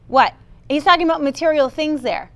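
ordinary talk, nothing marked

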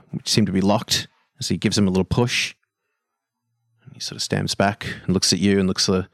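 ordinary talk, nothing marked

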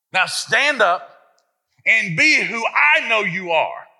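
rally the mothers to their feet; rousing call. Real, not acted. Preacher to mothers, rising up loud.

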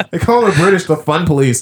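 usual speaking voice

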